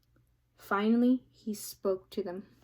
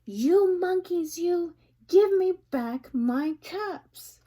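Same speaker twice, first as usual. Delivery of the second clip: higher, louder, slow shout; angry peddler; 8 semitones higher, 7 dB louder, slower than usual. Acted. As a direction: bellow up into the tree. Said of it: "shook both hands at them and said".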